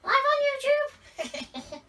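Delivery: very high, loud squeaky impression; mock shriek, comically unlike the real voice; Mister Producer. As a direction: squeal it in a silly put-on voice, drawn out at the end. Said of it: "Mister Producer doesn't talk like that".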